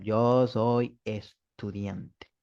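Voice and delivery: slow, careful model sentence; spoken slowly as a teaching example. Shown as a demonstration. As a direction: spell it out slowly for the class. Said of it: "yo digo aquí en español".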